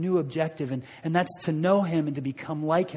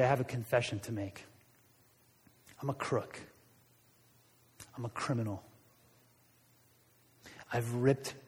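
softer and lower, a quiet guilty admission; remorseful confession; 4 semitones lower, 6 dB quieter than usual. Acted. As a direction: confess it hushed, ashamed of the theft. Zacchaeus confesses to everybody.

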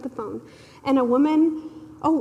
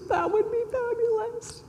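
high, tearful voice breaking; teary and high, voice cracking; holding back tears; 11 semitones higher, 3 dB quieter than usual. Retold or imitated. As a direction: choke it out through tears. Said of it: "am just sucking back the tears".

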